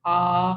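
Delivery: आ held on a level pitch; first tone, straight. Shown as a demonstration. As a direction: keep आ even from start to end. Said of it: "straight रहेगी".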